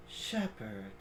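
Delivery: low, soft and slow, intrigued; hushed curious creature; ancient creature voice. Acted. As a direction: murmur it with quiet interest.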